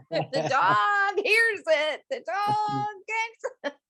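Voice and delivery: high, slow, laughing through words. Real, not acted. Psychic cracking up as she tells her host about the dog. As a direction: giggle it out.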